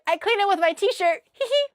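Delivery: high, loud mock glee with squeaky giggle; happy and proud. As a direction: imitate his pleased boast, ending in a tittering hee-hee. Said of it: "He was so happy about it".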